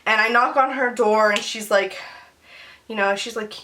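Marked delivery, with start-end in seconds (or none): none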